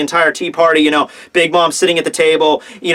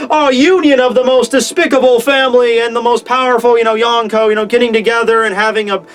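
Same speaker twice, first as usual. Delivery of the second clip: put-on grand proclamation, higher at the start; MC announcer; 4 semitones higher than usual. MC at the microphone hailing the union, announcer voice. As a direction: imitate a wedding emcee opening the ceremony. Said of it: "M C get on with the fucking, you know, m-, microphone".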